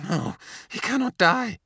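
high, urgent inner voice; a frantic silent vow; desperate thought. A narrator's reading.

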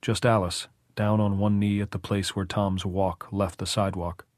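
ordinary delivery

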